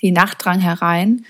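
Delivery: plain reading voice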